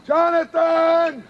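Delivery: long, slow shout of the name; searching for his vanished partner, calling loudly. Acted. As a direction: call out across the street, worried.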